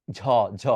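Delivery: 'ঝ' as a hard aspirated jh; Bengali jh. Shown as a demonstration. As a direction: say 'ঝ' with a stop and a puff. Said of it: "বাংলায় আছে আপনার কি জানেন". ('ঝ' বাংলার মহাপ্রাণ ধ্বনি)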